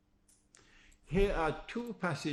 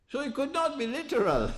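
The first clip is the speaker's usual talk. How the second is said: triumphant conclusion; 12 semitones higher, 4 dB louder than usual. Real, not acted. Punchline of his argument.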